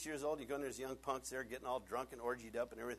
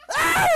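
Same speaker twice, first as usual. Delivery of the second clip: shout; 25 dB louder than usual. Acted. Shouting punchline.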